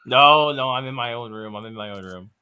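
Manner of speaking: ordinary delivery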